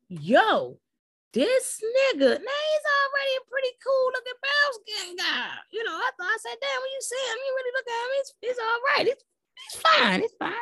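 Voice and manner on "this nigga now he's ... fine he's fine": very high squeal of admiration; high, giddy reaction to a good-looking man; high-pitched gushing. Retold or imitated. Gushing over character's looks.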